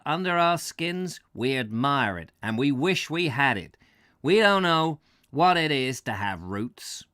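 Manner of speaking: put-on light New England American accent, lively. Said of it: "that's a New Englander accent".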